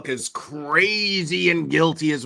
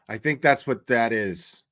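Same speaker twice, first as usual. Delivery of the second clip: ordinary delivery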